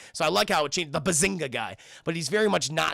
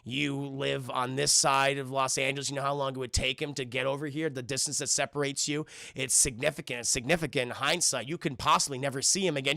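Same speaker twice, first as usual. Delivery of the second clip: low, even, measured explaining; mock-nerdy lecturing, pitched low; math speak; 5 semitones lower, 3 dB quieter than usual. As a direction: reason it out like a dry calculation. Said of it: "he starts doing math speak".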